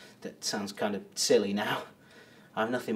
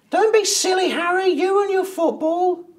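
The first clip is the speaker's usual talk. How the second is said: mocking falsetto put-down, much higher and louder; mimicking the wife; 6 dB louder than usual. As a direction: squawk it like a nagging wife. Man mimics his wife's scolding.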